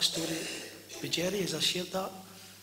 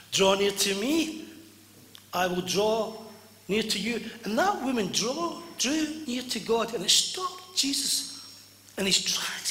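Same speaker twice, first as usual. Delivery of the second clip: loud, raised preacher's voice; full-force preaching; 3 semitones higher, 4 dB louder than usual. Acted. Preacher telling how the woman drew near, full-force preaching.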